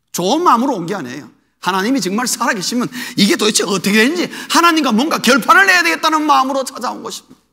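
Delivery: ordinary delivery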